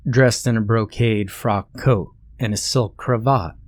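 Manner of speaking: ordinary delivery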